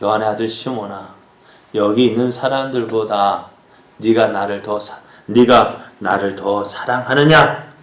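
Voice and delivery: low, softened question to Simon; gentle questioning. Acted. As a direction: ask gently, drawing out the end. Jesus gently recalls Peter's promise.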